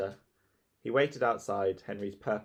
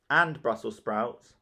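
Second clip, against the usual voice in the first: ordinary delivery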